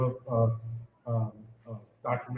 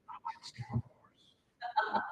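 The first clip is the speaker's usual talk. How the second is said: hushed, slow whisper; imitating a shy girl's whisper; 8 dB quieter, much slower than usual. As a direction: whisper it shyly like a secret. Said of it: "why are you whispering".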